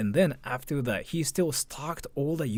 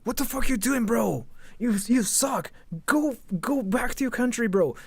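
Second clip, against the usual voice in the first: high, mocking angry bro voice; mocking Hezma; 9 semitones higher than usual. Streamer imitating Hezma scolding a foreign tourist, high mock taunt. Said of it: "Hezma ... go like".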